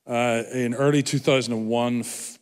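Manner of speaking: usual speaking voice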